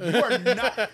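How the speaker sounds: dad laughingly scolding his daughter; laughing mock scold. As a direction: scold her through a laugh.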